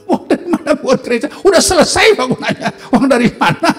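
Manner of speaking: high, loud mimicry through laughter; incredulous retelling. Caller asking where church money came from, laughing disbelief. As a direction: act out astonished phone back-and-forth, laughing. Retold astonished phone exchange about church funds.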